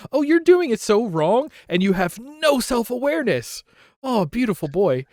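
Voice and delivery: high, gushing "oh, you poor fool"; high-pitched, affectionate dismay; fond mock pity. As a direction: coo over a hopelessly wrong darling. Cooing at clueless confident character.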